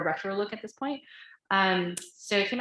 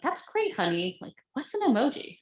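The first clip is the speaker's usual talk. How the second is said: lightly voiced mom, pitched up high; high-pitched mock-encouraging mom, then puzzled; imitating a mom; 6 semitones higher than usual. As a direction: retell it as an indulgent, puzzled mom. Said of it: "her mom was like".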